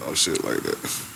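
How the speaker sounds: usual speaking voice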